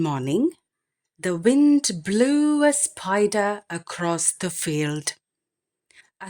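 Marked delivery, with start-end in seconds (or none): none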